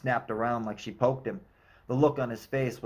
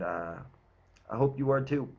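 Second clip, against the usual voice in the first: plain reading voice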